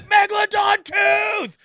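high, slow, drawn-out thrilled yell; excited kid scream. Retold or imitated. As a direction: scream it like a boy who got his dream gift. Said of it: "he starts screaming at me".

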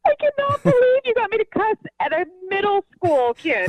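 high, laughing through the words. Real, not acted. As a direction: laugh it out in disbelief. Laughing after the prank reveal.

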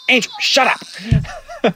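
a hammed-up mum shushing her husband, stretched out; mum scolding. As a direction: mum whacking dad, squealing at him slowly to shut up. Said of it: "my mum's whack".

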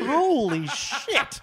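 high, slow, laughing holy shit; laughing disbelief. Real, not acted. Friend hearing the wild Walgett story, laughing slowly. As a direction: crack up in amazed disbelief.

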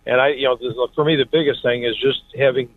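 speakerphone from a car; chatting in a car. Real, not acted. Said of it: "you sound like you're in the car".

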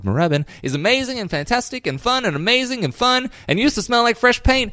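bouncy sales pitch, piling up the praise; ad read. Real, not acted. Host plugging Dom and Ozzy's new shop, animated ad read. Resumes the sponsor's ad.

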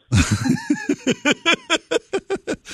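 a big, high laugh; high cackle of laughter. Real, not acted. Guest roaring with laughter at his own joke. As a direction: break up laughing. Laugh after "what's a scale.

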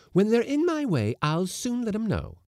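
very high, indignant retort; loud defiance. A narrator's reading. Cook claiming charge of her galley, high defiant voice.

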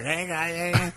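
mock creepy politician voice; creepy Biden. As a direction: imitate a creepy old politician calling out. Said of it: "he looks so creepy".

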